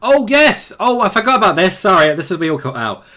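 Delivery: high, loud burst of recognition; excited realisation. Real, not acted. Host suddenly remembers the co-host's news, excited realisation. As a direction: exclaim it as the memory rushes back.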